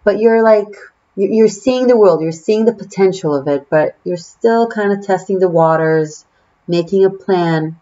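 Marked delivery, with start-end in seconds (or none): none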